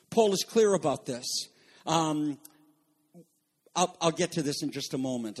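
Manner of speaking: ordinary talk, nothing marked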